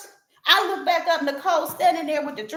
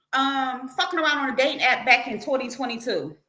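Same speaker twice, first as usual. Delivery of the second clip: usual speaking voice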